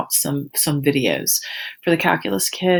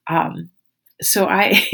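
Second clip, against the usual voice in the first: ordinary talk, nothing marked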